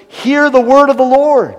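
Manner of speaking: high, loud ringing proclamation; proclaiming. Acted. Preacher commanding the dry bones, ringing proclamation. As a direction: cry it out over the dry bones.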